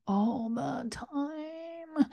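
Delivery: long, thin, high trailing TIME; drawn-out weariness. Acted. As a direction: stretch it out, ending high and soft. Voicing endless work.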